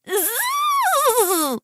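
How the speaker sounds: high, soft imitated bee buzz; long zzz of a bee.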